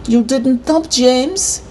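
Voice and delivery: high, slow scolding; mad. A narrator's reading. Neighbour tells the boy off, all mad. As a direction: scold him in an angry neighbour's voice. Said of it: "all mad".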